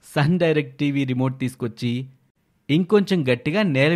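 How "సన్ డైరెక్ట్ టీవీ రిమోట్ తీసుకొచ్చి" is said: plain reading voice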